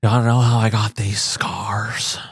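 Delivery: slow, whispery Joker impression; Heath Ledger's Joker. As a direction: whisper it slowly like a menacing movie Joker. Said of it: "kind of sounds like Jack Nicholson".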